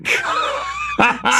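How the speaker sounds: high-pitched burst of laughter; laughing. Real, not acted. Storyteller cracking up over the daughter's swearing, laughing. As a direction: crack up at your own story.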